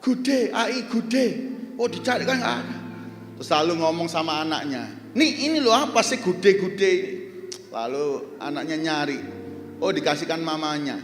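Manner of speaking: rousing sermon delivery with call and response; preaching. Acted. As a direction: preach it to the congregation.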